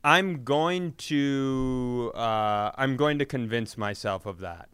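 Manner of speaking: ordinary talk, nothing marked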